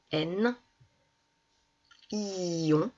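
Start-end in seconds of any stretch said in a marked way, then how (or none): 2.1-2.9 "ion" with a long i into nasal on; drawn-out I. Shown as a demonstration. Teacher sounding the last syllable, i drawn out. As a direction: hold the i of "ion", then glide. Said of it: "la dernière syllabe".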